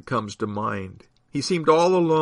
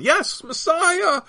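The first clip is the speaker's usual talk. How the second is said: high, drawn-out answering cry; shout; 4 dB louder, slower than usual. A narrator's reading. Another in the crowd agreeing, high shout. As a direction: shout back slowly in agreement. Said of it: "shouted another".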